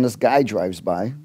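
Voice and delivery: ordinary delivery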